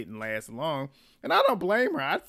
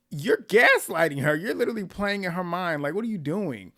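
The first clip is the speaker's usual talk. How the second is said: higher, exasperated call-out, loud at the start; exasperated scolding; 5 semitones higher, 5 dB louder than usual. Real, not acted. Viewer telling off the man for gaslighting her, exasperated. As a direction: call him out, fed up.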